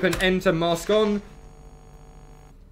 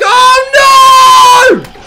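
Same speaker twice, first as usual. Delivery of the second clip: very high, loud, slow scream of no; terrified scream; 16 dB louder than usual. Real, not acted. Player about to die, drawn-out terrified scream. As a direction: scream as it gets you.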